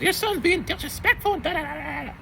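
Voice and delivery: a hint of a prim complaint letter; mock formal email voice. Teacher mocking his own email to the mom, high prissy tone. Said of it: "I have his mom's email".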